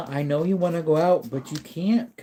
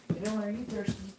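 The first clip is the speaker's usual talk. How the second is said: soft pet talk; 7 semitones higher, 13 dB quieter, faster than usual. Real, not acted. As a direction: murmur sweetly to the pup. Asking his dog again.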